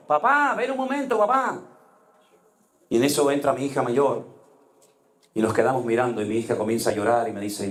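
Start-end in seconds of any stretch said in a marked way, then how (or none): 0.1-1.6 high, insistent call of a confused daughter. Retold or imitated. Confused sick daughter calling her father from bed, high and insistent. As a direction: call out papá up high, pleading, each one falling.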